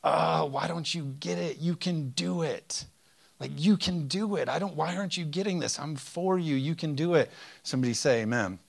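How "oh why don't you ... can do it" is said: exasperated pleading God. Retold or imitated. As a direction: plead like a frustrated father who believes in them. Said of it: "came from a place of anger of like".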